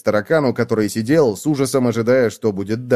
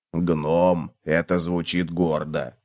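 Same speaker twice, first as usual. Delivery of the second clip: low, slow, soft bug voice; a slow, low-down cockroach; 6 semitones lower, 4 dB quieter, slower than usual. A narrator's reading. Cockroach voice in story.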